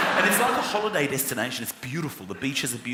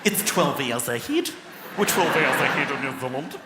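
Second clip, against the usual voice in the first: put-on a deadpan Kiwi accent; New Zealand accent, mock stewardess. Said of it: "She went".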